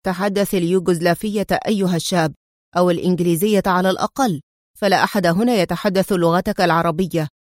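ordinary delivery, nothing marked

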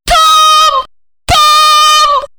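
very high, loud terrified squeal; screeching. A narrator's reading. Olga pointing into the hiding place, screeching. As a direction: shriek it in horror. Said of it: "и визжала".

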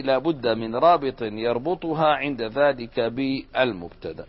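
normal talking voice

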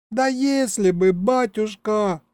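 high, drawn-out tearful lament; tearful young woman. A narrator's reading. Sobbing girl answers the men's questions, acted tearful. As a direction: voice a girl whimpering through tears. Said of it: "всхлипнула девушка".

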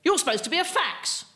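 high-pitched mock indignation; indignant caller. Caller complaining to whoever picked up the landline, high indignant voice. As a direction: squawk it as an affronted caller.

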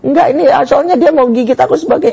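high-pitched flustered self-defence; protesting father. Acted. Father explains the bite to mother, high protesting voice.

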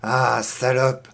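low, slow growled insult; muttered insult. Acted. Boy pinning the girl down, repeating the insult. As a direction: drag the insult out, low and menacing. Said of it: "ne cessait-il de répéter".

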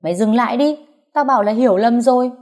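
shouting. Acted. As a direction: yell at her to stop. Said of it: "rồi hét lên".

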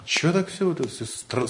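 usual speaking voice

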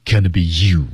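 you 用降调往下落. Shown as a demonstration. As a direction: gonna be you 句尾降下来. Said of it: "降调啊". (you 读成降调)

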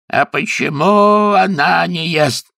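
high, drawn-out demand; indignant challenge. A narrator's reading. Lord challenging niece over pig, high indignant wail. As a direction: wail the question high and stretched.

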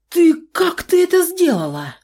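shocked, high-pitched question; stunned. Acted. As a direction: gasp it out in disbelief. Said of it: "потрясённо спросил Тайлер".

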